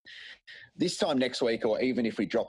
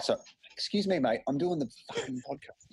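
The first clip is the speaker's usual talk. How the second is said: turning away from phone; 8 semitones higher than usual. Real, not acted. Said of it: "Sorry, that was my daughter".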